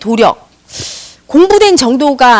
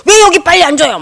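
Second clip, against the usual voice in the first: mild mock outburst, pitched up; a touch of acted restaurant anger; imitated angry yelling; 5 semitones higher, 4 dB louder than usual. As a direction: imitate a hot-tempered customer complaining. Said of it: "막 이렇게 화를 잘 낸대요".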